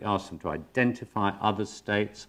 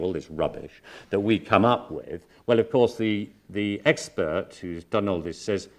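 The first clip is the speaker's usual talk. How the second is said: usual speaking voice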